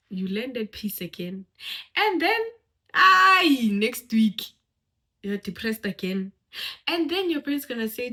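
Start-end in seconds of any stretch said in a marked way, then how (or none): 1.9-4.4 bursts out loud and high on aye; a loud, high yelp to grab attention; sudden loud exclaim. Real, not acted. Speaker to audience, jolting them with a loud yell.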